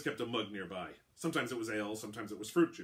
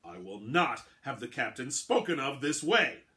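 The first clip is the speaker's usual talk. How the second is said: slow, loud, furious warning; angry threat; 4 semitones higher, 8 dB louder, slower than usual. Acted. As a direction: lay down each word as a threat. Quartermaster threatens with dagger.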